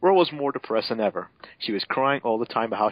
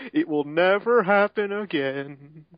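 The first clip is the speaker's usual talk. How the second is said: slow, higher, hammed-up earnest promise; mock solemn vow; 6 semitones higher, 3 dB louder, much slower than usual. Mega Man promising Roll, slow mock-solemn vow. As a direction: drag out the vow melodramatically. Dramatic fanfic apology line.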